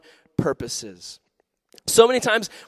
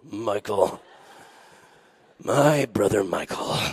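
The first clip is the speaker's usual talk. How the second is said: mock bitterness; 7 semitones lower, slower than usual. Mock-naming his arch enemy.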